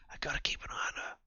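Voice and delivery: fast, whispered aside. Acted. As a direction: whisper it privately, quickly. Second take, whispered.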